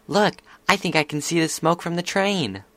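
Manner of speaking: high-pitched filly voice; excited filly. A narrator's reading. Filly pointing out the train to friends, excited.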